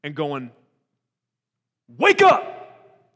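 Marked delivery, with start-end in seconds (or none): 2.0-3.1 much higher, very loud wake-up shout. Acted. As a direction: shake someone awake with a yell. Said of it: "grabbing Elijah by the shoulders and going".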